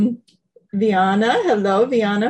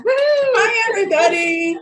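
bubbly hello; 10 semitones higher, slower than usual. Real, not acted. Cheery greeting opening the show.

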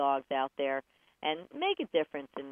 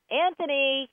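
high, raised parent's call; dinner-time call; 8 semitones higher, 5 dB louder than usual. Acted. Parent calls the child to dinner, high calling voice. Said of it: "that's probably dinner time".

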